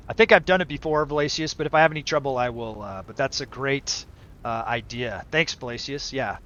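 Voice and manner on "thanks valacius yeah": ordinary delivery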